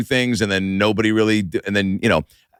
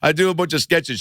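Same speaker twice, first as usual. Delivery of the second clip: higher-pitched, stammered mock explanation; sheepish excuse; 7 semitones higher than usual. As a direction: explain yourself awkwardly, half laughing.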